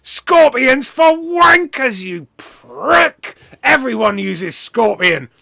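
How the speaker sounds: high, loud, drawn-out ranting; scornful shouting. Retold or imitated. Wrestler scolding the man over picking Scorpion, shouting. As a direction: bellow each word like a furious verdict. Acting out wrestler's angry rant.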